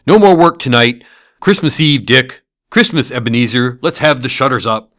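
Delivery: put-on rally the boys to close up for Christmas; jovial cry. A narrator's reading. Fezziwig calling his apprentices to stop work, cheerful shout. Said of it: "cried old Fezziwig".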